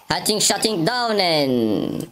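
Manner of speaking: high, stretched-out mock battle cry; drawn-out mock cry. Gamer faces noisy enemy, long high mock cry. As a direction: draw the last word right out, high.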